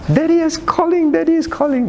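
high-pitched imitation of an excited child. Teacher imitates pupil when daddy calls on the iPad, excited child.

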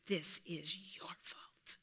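hushed, voiceless taunt; whispered accusation in the ear. Acted. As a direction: breathe it like a sly tempter.

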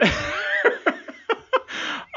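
high airy laugh; breathy laugh. Real, not acted. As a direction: burst out laughing. Laughing at the Death scene.

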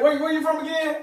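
high-pitched, eager mock question; imitated questioner. Outsiders asking to hear the accent, high imitated questioning. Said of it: "They be like".

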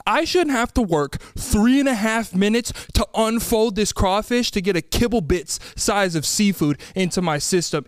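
normal talking voice

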